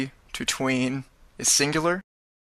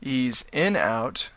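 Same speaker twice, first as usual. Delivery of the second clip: dictating; much slower than usual. Shown as a demonstration. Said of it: "Type it like that".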